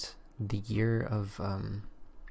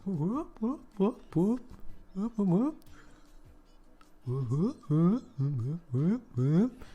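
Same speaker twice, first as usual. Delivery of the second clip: high rising bird whoops; Kazooie; 9 semitones higher, slower than usual. Shown as a demonstration. Streamer showing off best bird impression, high whoops. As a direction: whoop upward like a cartoon bird. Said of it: "That's my best Kazooie".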